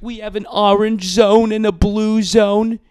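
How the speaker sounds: high, slow, put-on Cuomo voice; pompous governor announcement, drawn out; Cuomo impression. Podcaster mocking the governor's briefing, pompous slow voice. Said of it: "That's how he talks".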